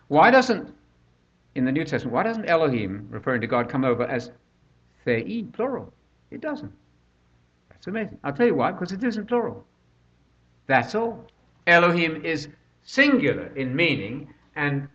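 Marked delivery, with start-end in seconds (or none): none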